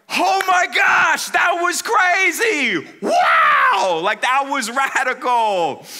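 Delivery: high, loud, slow whooping shout; wild cheer. Retold or imitated. Friends yelling in awe at the crash, high and loud.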